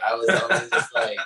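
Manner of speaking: ordinary delivery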